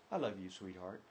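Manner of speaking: tender. Shown as a demonstration. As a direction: say it like you truly mean it. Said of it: "Same words".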